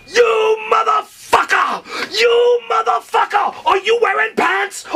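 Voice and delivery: very high, enraged screech; going crazy. Retold or imitated. Host catching naked drunk guest on his couch, going crazy. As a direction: draw out the curse in a high, outraged yell. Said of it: "he's going crazy".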